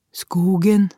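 put-on child's answer. A narrator's reading. Child's answer to worried mother.

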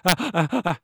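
ah ah alternating breath in and breath out; in-and-out laugh. Shown as a demonstration. Showing babies' ingressive laughter, ah ah in and out. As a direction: laugh like a baby, gasping in between. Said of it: "that's in and out".

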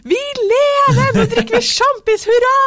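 very high, loud, jubilant cry; overjoyed. Retold or imitated. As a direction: cheer like you just survived. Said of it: "jeg var mer sånn".

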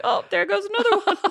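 high, laughing, mock-resigned aside; laughing shrug of a line; laughing mock resignation. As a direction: giggle through it as if waving the racers past. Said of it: "You'd be like".

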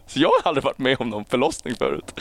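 usual speaking voice

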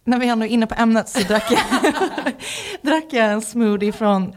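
words breaking up into laughter; laughing while talking. Real, not acted. Podcast guest starting another smoothie story, laughing through it. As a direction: try to go on through the giggles.

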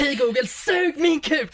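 high, slow laughing command; mock angry order to the gadget; mock yell at speaker. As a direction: bark it at the smart speaker, half laughing. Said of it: "och skriker".

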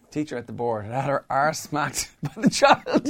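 high, loud, breaking into laughter; helpless giggles between the words; laughing storytelling. Real, not acted. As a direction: tell it while cracking up. Cracking up telling smacked-teacher story.

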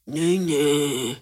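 drawn-out mock drunken call; imitated drunken voice. Drunk girl calling for her best friend at a party, imitated drunken whine. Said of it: "Jeg kan bare huske den der stemme".

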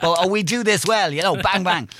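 high, loud mock thrusting rhythm; playful over-the-top demonstration; hammed-up jackhammer demo. Shown as a demonstration. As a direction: act out the jackhammer with punchy bangs. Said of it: "I just needed to demonstrate".

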